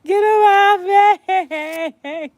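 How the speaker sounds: high, loud, stretched-out wail; hammed-up frantic screaming; panicked scream. Arguing with fleeing classmate over the spider, panicked.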